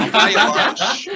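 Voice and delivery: high, loud, slow laughing mock pitch; hammed-up pushy salesman; scammer voice. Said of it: "not sounding like a scammer".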